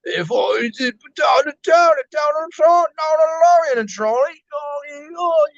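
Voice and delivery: very high, garbled British gibberish; hammed-up, high-pitched British send-up; mock British accent. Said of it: "English is a British?".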